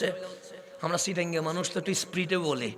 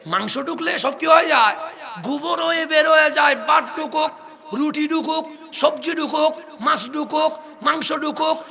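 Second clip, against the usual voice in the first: preaching at full force; 10 semitones higher, 14 dB louder than usual. Real, not acted. Preacher rousing the congregation at a mahfil, full-force sermon cry. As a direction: preach it out to the whole crowd.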